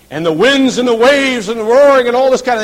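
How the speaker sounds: loud, high dramatic swell; storm-tossed, full-voiced narration; roaring storm. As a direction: cry out over wind and waves.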